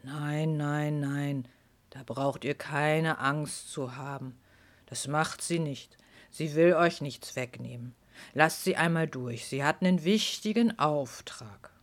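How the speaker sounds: deep, slow reassuring voice; gentle giant. Acted. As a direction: soothe them slowly and low. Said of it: "sprach mit tiefer Stimme".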